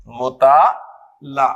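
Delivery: drawn out very slowly, falling at the end; slow model word. Shown as a demonstration. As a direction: spell out each syllable for the class. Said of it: "तो वो था जी मुताला".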